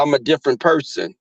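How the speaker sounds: usual speaking voice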